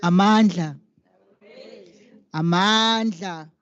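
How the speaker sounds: ordinary talk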